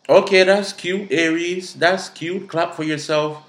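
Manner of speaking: high-pitched patronizing congratulations; sassy, belittling applause; mocking praise. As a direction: talk down with sarcastic approval. Mock praise of the boaster.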